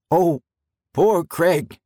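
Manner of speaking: high, grieving moan repeated. A narrator's reading. President kneeling by dead Craig, high grieving moan. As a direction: lament over the fallen guard. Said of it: "he moaned".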